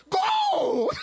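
very high, loud shout; yelled command. Retold or imitated. Preacher to the demon, very high loud shout. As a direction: blast one word at the demon. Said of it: "So I yelled".

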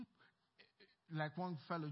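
ordinary talk, nothing marked

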